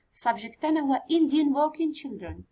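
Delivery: ordinary delivery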